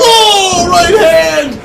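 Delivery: sudden loud high call; excited shout. Real, not acted. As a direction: yell it as the punch lands. Calling a big punch live.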